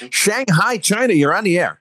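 high, loud on-air announcer intro; radio host call-in. Acted. Host takes a caller on air.

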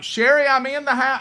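high, loud sing-song announcement; loud announcing. Retold or imitated. Husband warning his wife he is coming in the house. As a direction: announce yourself so she won't scream. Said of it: "I'm like".

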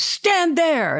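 sharp high command; barked order. Retold or imitated. Bill Murray pointing him to his mark, a high command. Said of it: "he says".

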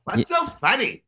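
echoing the perplexed Lovitz line slowly; perplexed Lovitz impression. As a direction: ask it slowly like a baffled Lovitz. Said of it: "He's always genuinely perplexed".

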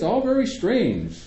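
a hint of a pondering doctor; musing doctor. A narrator's reading. Doctor puzzles over Greg's reaction, slowly.

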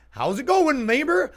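high, loud cheery neighbor hello; overly friendly greeting. Retold or imitated. Guy greeting a passerby, high and loud cheery.

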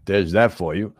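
ordinary talk

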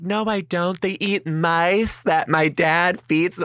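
high-pitched mimicked child protest; insistent little girl. Lying girl doubles down, acted.

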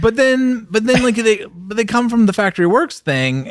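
high, emphatic disbelief; rising indignant but then; excited protest. Real, not acted. Excited objection.

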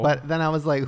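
ordinary talk, nothing marked

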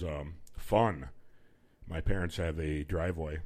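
ordinary talk

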